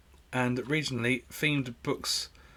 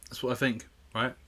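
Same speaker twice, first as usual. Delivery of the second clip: usual speaking voice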